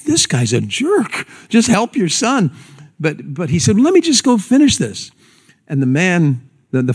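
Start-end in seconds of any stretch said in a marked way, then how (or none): 0.0-2.5 drawn-out gripe rising into a higher plea; indignant onlooker. Retold or imitated. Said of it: "And you're thinking". 3.8-5.1 high-pitched, insistent mock plea; pleading helper. Onlooker pleads to help the boy.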